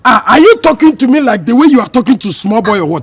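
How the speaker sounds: loud, high indignation; affronted, heated protest; offended outburst. Acted. Offended at being talked down to.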